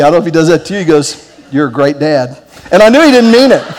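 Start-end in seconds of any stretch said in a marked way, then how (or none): 1.5-2.4 sarcastic. Retold or imitated. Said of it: "he'll speak sarcastically to me".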